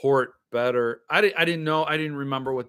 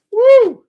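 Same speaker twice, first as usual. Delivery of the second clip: loud high whoop of delight; excited whoop; 15 dB louder than usual. Real, not acted. Whooping at the junk drawer, loud and high. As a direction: let out a big woo.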